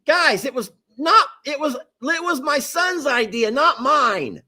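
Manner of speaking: high, insistent excuse; emphatic high-pitched self-defense; defensive protest. Real, not acted. Shifting blame to son.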